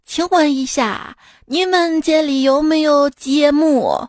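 slow, halting foreigner's Mandarin; foreigner speaking Chinese. Retold or imitated. Foreign friend asking the waiter, slow accented Mandarin. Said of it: "外国朋友自己把服务员叫过来".

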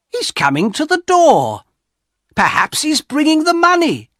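put-on hopeful old wife, rushing her words; excited. A narrator's reading. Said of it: "She was very excited".

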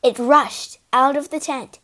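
plain reading voice

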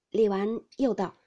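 ordinary delivery, nothing marked

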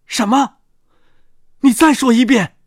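high, loud startled question; startled disbelief. Acted. Convict hears unexpected words, startled and loud.

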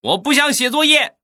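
put-on very high, loud kid's yell; loud shouting. Nephew refusing homework at uncle's urging, loud high shout. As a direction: imitate a boy hollering in protest. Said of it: "然后他大声的喊道".

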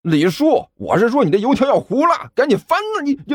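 high, frantic warning; urgent warning. A narrator's reading. Helper warning the uncle his fritters will burn, urgent. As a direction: pitch climbing as you hurry him. Helper warns of burning food.

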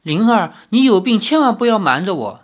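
urgent, rising questions, pressing hard; insistent pressing. A narrator's reading. 李逍遥 presses 灵儿 about her illness, urgently. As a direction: press her for the truth, worried. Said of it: "李逍遥不放弃的追问".

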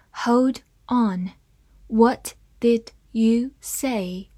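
slowed down, each word drawn out; slow read. Shown as a demonstration. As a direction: read it slowly for the class. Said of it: "我们慢慢来读". (慢慢地朗读)

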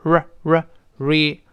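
“r” with the tongue tip curled back. Shown as a demonstration. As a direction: build from a lone “r” into “re”, piece by piece. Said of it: "字母R要舌尖往回勾". (舌尖回勾发“r”，再拼成“re”)

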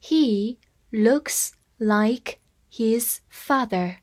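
very slow, word by word; slow reading. Shown as a demonstration. Teacher reading slowly to students, word by word. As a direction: read it slowly for learners. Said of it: "我们慢慢来读一下".

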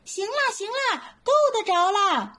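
high eager little monkey shout; excited call. Acted. Little monkey calls up from the well, high and eager. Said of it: "他在井里喊".

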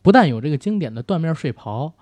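normal talking voice

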